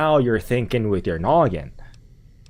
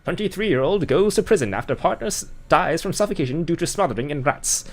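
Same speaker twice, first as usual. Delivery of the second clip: higher, bright broadcast read; mock news anchor; 6 semitones higher than usual. Mock newscaster reporting the rat death, anchor voice. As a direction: announce it like a TV newsreader.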